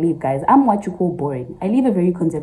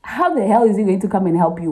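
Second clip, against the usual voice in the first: usual speaking voice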